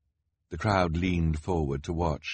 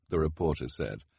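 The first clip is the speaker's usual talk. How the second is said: usual speaking voice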